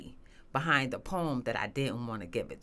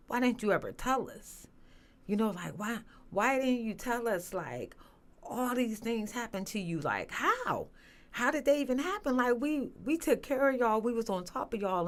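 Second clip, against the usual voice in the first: higher, tearful pleading as the father breaks down; retold crying father; 8 semitones higher than usual. Father learning of her abuse, higher, crying and pleading. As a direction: voice him high and choked, asking why she never told. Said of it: "it sounded like he was crying".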